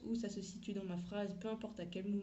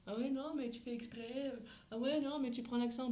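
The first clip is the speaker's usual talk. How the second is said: higher, jeering imitation of a critic; mocking critic; 5 semitones higher than usual. Bystander picking on someone speaking English, mocking imitation. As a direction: pile on the reproaches, mimicking a scoffer. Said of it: "je me fais défoncer par quelqu'un autour de moi qui dit".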